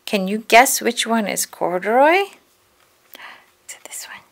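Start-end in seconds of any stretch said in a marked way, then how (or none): 2.2-4.2 slow, whispered question; hushed guessing. A narrator's reading. Reader playing a guessing game with children, whispering. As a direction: whisper it teasingly.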